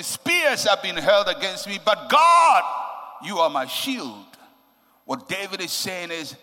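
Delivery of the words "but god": but God rises loud and high; emphatic shout. Acted. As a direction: proclaim it, voice leaping up. Preacher's climactic declaration.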